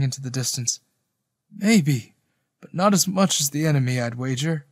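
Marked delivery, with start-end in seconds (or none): none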